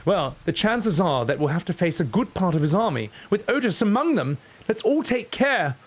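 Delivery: high-pitched, urgent character voice; worried game character. Acted. Streamer voicing game character warning companions, high and urgent. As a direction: read it as an anxious adventurer rallying companions.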